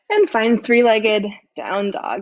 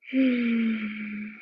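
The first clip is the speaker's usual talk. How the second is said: relaxed sigh; 5 dB quieter than usual. Real, not acted. Settling into Shavasana.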